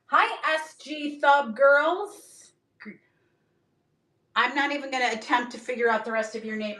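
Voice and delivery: ordinary delivery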